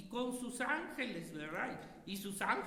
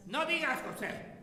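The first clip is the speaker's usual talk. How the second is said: raised, louder call across the room; 3 semitones higher, 5 dB louder than usual. Real, not acted. Preacher calls out to José in class, loud.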